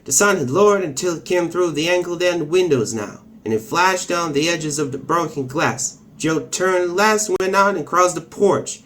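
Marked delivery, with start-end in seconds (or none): none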